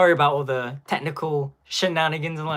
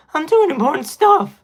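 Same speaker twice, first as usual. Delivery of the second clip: high, loud mock whine; hammed-up squeaky teen protest; petulant teenage Superman; 13 semitones higher, 8 dB louder than usual. Mimicking Superman being yelled at, petulant teenage whine. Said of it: "Mister Terrific is yelling at him".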